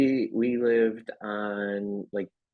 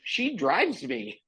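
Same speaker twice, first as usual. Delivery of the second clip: high mocking go-ahead; big sibling calling his bluff, higher; taunting dare; 11 semitones higher than usual. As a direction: dare him, pitched up and cocky.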